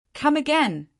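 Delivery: loud, high battle challenge; roar. A narrator's reading. Stone Emperor waving fists for another round, roaring. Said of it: "he roared".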